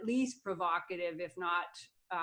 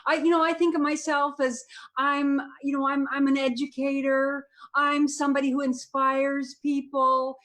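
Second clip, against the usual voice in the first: Tomi Lahren impression; 3 semitones higher, 5 dB louder than usual. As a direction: lecture the men like a self-satisfied commentator. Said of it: "Come on, let's see it".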